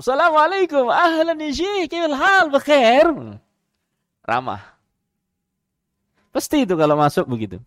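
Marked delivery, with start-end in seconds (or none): 0.0-3.1 high, bright Arabic greeting, much higher; friendly greeting. Retold or imitated. Sheikh greeting the guard warmly, high and friendly. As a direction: call out a cheerful salam like a friendly sheikh. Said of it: "pasti salam".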